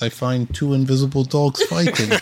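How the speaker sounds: put-on Russian accent. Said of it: "Now that was good".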